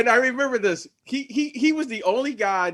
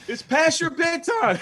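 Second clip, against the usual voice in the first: mock taunting shout; 10 semitones higher than usual. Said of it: "He would always shout".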